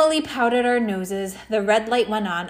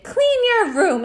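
high, loud motherly scolding; mom scolding; 11 semitones higher, 6 dB louder than usual. Acted. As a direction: nag him to clean his room, high and loud.

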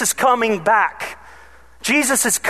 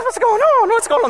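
startled alarm; 12 semitones higher than usual. Retold or imitated. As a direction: cry out in sudden alarm. Babysitter hit by dirt.

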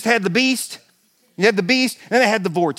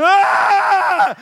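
high, loud terrified scream; 10 semitones higher, 5 dB louder than usual. Retold or imitated. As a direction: scream as the coaster drops. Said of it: "I go".

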